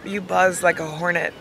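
ordinary talk, nothing marked

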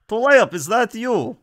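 high, loud acted call of recognition; shouting past someone. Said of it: "sort of shouts past".

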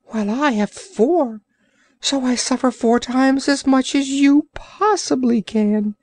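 high, whiny creature voice; self-pitying Orc. Acted. Orc pitying himself to the sailor, high whine. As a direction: moan about suffering as the Orc.

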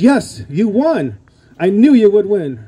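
wife cheering him on; excited wife. Retold or imitated. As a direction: voice the wife's happy text. Wife's text voiced excitedly.

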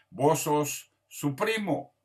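slow, careful Central American voseo. Shown as a demonstration. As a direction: model the Salvadoran vos form slowly. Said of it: "si somos centroamericanos o salvadoreños". (voseo centroamericano lento)

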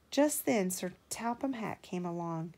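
usual speaking voice

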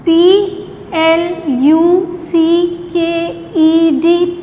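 letters spelt out slowly one by one; slow spelling drill. Shown as a demonstration. Teacher spelling a word slowly for children to repeat. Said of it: "You have to repeat every word twice with me".

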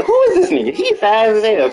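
stunned outcry. Real, not acted. Gamer shouting at a fast runner on screen. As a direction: yell it in shock.

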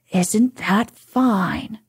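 ordinary delivery, nothing marked

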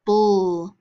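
BULL as a drawn-out "bul" syllable; isolated syllable sound. Shown as a demonstration. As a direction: sound out "bull" as the -ble ending. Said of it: "Final syllable".

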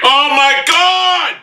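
loud, high, drawn-out cry of frustration; exasperated yell, stretched out; frustrated outburst. Real, not acted. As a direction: wail it loudly as the game is lost. Gamer outburst after losing.

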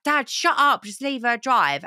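सख़्त, ऊँची आवाज़ में डाँटना; telling dad off. Retold or imitated. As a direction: snap at dad to stop nagging, loud and high. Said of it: "I'm like".

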